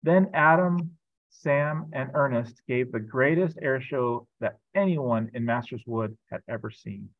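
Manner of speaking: lively storybook reading voice; reading to children. A narrator's reading. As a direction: read it like a picture book aloud. Pastor reads a children's book.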